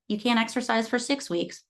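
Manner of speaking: normal talking voice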